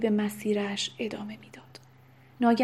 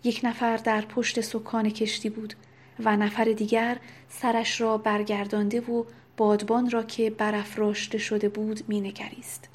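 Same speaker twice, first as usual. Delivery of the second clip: usual speaking voice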